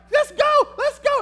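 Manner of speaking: very high, loud panicked plea; frantic urging. Retold or imitated. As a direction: beg your wife to get moving now. Urging wife to flee snake.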